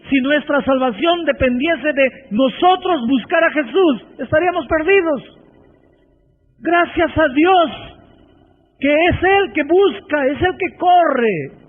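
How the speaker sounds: predicando con fuerza y pausas; sermón apasionado, subrayando cada frase; predicación. Acted. (predicación enfática)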